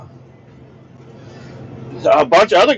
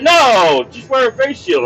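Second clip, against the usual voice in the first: lightly voiced office lady; 9 semitones higher than usual. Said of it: "She said".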